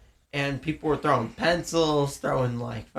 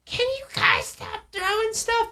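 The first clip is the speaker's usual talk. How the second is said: much higher, imitating an annoyed girl; mock girl's voice. Girl on the bus telling kids to stop throwing, high mock annoyed voice. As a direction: squeak it like a fed-up teenage girl.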